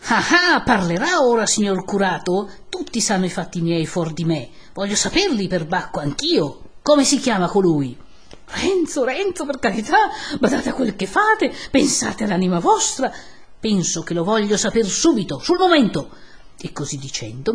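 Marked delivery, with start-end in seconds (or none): 0.1-8.0 threatening demand. Acted. Renzo cornering the curate for a name, threatening. 8.5-13.1 high, loud, urgent begging; alarmed pleading. Acted. Don Abbondio begging Renzo for mercy, alarmed pleading. 13.6-16.1 loud insistence, jumping high at the end; angry demand. Acted. Renzo demanding the name at once, angry.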